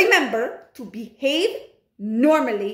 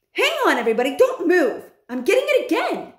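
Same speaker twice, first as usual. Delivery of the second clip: excited witch; 9 semitones higher, 5 dB louder, faster than usual. Acted. Mildred catching the smell.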